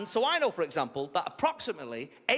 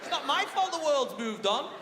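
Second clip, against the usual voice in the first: indignant protest; 9 semitones higher than usual. Acted. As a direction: protest to the teacher, pitched right up. Acted protest to head teacher.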